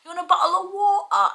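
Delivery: loud, high-pitched exaggerated British accent; hammed-up impersonation of a Brit; mock British accent. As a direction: overblown British caricature, shrieked up high. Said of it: "American impersonation of a British person".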